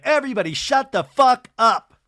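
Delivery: high, loud mock outburst; imitated angry shout; exasperated yell. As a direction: silence the whole room with it. Angie silencing the room, retold.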